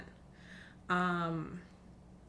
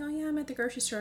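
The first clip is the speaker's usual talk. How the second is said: higher carefree mom, lightly done; light breezy mom voice; 8 semitones higher, 4 dB louder, much faster than usual. Retold or imitated. Mom calling from the grocery store, breezy. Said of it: "my mom calling me".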